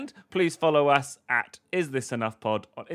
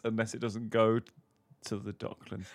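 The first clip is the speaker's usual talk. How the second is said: ordinary talk, nothing marked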